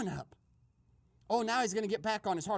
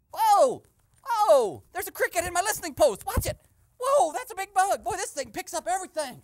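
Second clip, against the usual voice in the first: loud, high, rushed alarm; startled yelling; 7 semitones higher, 11 dB louder than usual. Pretend. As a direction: yelp in shock at a bug. Startled by cricket in listening device.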